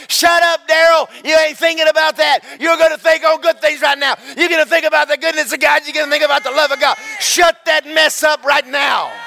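shouting at himself. Acted. Preacher shouts down his own thoughts while driving. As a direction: yell the devil's thoughts down. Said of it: "you just ride down the road going".

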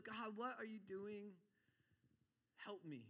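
softly, helplessly asking God for help; quiet plea. Acted. Preacher voices a believer in trial praying, quiet plea. Acted desperate prayer in trial.

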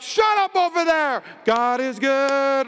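yelling over the worship music, mock angry; mock shout. Preacher mocks a churchgoer yelling at home, mock shout. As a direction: snap at the family, then force out the praise. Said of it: "When you're like".

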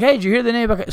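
high, loud mock radio announcer; mock radio host. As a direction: chirp it out like a cheesy radio guy.

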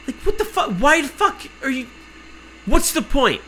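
high-pitched incredulous outburst; baffled, exasperated squeal of protest; exasperated disbelief. Retold or imitated. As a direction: voice jumps way up in disbelief. Exasperated rant at pointless checks.

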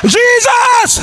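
high, emphatic cry for help; calling out. Shown as a demonstration. Preacher leading congregation to call on Jesus, high cry. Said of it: "Can somebody say".

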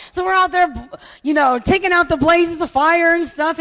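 ordinary delivery

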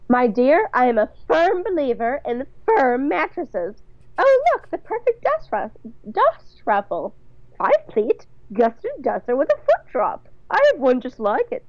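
high, fluttery society-matron voice; affected, swooping and very sure of herself; Bunny, imperious mother-in-law. Acted. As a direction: pronounce on bedding with grand authority.

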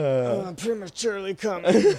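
slow, high, put-on voice; mock prissy quote. Man joking to his friend, slow and high mock voice. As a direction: ham it up, drawn out and high.

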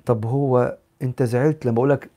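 ordinary delivery

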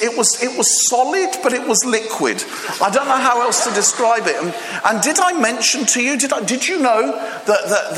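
excited storyteller. Retold or imitated.

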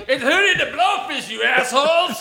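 put-on high, laughing drunk shout at the crowd. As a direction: yell like a drunk at karaoke. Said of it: "And you're like".

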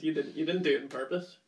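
ordinary talk

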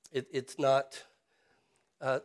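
normal talking voice

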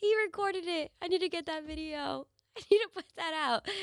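laughing hard while talking; cracking up, high and breathless. Real, not acted. Laughing at clip of friend.

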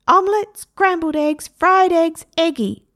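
high, loud parrot repeating the list. A narrator's reading. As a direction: squawk it back like a parrot. Parrot repeats Stephen's words.

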